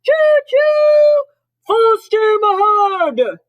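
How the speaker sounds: very high, loud train-whistle cry; full-force high-pitched conductor call; Santa shouting as conductor. A narrator's reading. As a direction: hoot and holler it, stretched out. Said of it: "Santa shouted".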